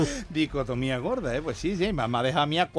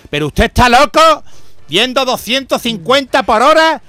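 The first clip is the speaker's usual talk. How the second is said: loud, higher scolding Guardia Civil officer; angry guard; 7 semitones higher, 11 dB louder than usual. Acted. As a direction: bark at the driver like an outraged cop. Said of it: "se van los guardias pa' él y le dice".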